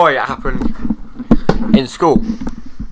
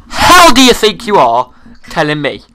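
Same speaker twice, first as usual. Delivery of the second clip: mock furious teacher; 4 semitones higher, 7 dB louder than usual. Retelling teacher's angry scolding.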